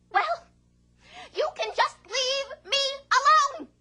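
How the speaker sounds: high, complaining vegetable voice rising to a squeak; whiny yam voice. Acted. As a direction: scold the farmer like a neglected yam.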